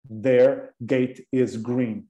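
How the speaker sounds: very slow, careful sentence; slow model sentence. Shown as a demonstration. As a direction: read it word by word for learners. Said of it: "O portão delas é verde".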